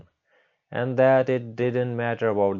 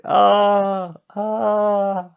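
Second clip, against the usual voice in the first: long high acted scream; drawn-out, high-pitched "aah" scream; 13 semitones higher, 5 dB louder than usual. Teacher screams at the sight of Kitano's face, high scream. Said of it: "Haruko started screaming".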